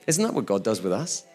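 normal talking voice

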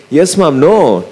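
put-on reassuring Mom in a Sri Lankan accent. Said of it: "I've flicked into my Sri Lankan self".

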